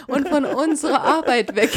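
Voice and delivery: lauter, lachend herausgeprustet. Real, not acted. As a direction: vor Lachen kaum weiterreden. Lacht beim Tadel über Hund. (lachend und lauter)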